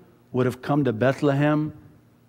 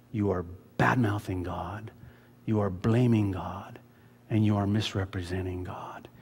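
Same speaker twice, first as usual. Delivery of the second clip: hushed, voiceless warning; grave whisper; 5 semitones lower, 6 dB quieter than usual. Real, not acted. Pastor warning his congregation against blaming God, whispered. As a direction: whisper it like a solemn secret.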